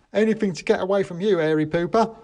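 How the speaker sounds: put-on high, cheeky children's voice; cheeky kids. A narrator's reading. Fleeing kids mocking the narrator, high and cheeky. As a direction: kids jeering as they run off. Kids' rude reply.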